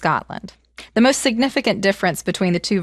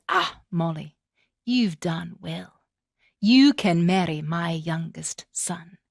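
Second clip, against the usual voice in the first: lower, soft and slow, ending in a whisper; gentle king; 4 semitones lower, 3 dB quieter, slower than usual. Acted. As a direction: praise her kindly. King rewards Molly.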